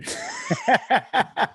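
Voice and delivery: high burst of laughter; real, rapid giggling laugh. Real, not acted. Guest teasing the host about late hours, laughing.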